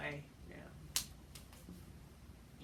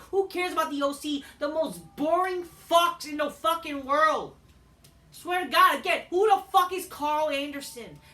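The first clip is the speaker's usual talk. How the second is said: furious rant; 7 semitones higher, 7 dB louder than usual. Real, not acted. Wrestling fan yelling at a boring backstage feud, furious. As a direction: shout it like you're fed up with the whole show. Ranting about wrestling storyline.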